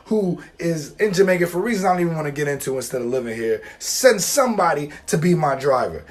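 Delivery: ordinary talk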